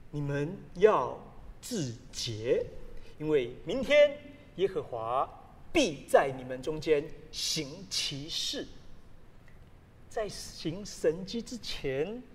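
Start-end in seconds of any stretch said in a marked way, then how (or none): 0.1-8.7 scripture reading. Acted. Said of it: "约书亚吩咐百姓说".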